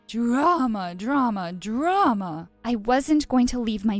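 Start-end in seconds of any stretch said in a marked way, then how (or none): 0.1-2.5 eye-rolling. Acted. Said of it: "Mom rolled her eyes and said".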